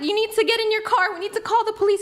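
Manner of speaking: high, breathless panicked stammer. Retold or imitated. She tells her boyfriend the car is gone, high and panicked. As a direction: blurt it out in shock, barely able to talk.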